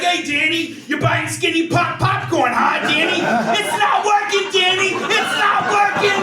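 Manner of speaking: high and loud taunting of Danny; mocking bully. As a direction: jeer at him like a schoolyard bully. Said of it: "He's like".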